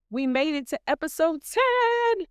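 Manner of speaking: high, slow, sing-song announcement; triumphant. Real, not acted. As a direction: savour the milestone, lifting each word. Celebrating reaching episode ten.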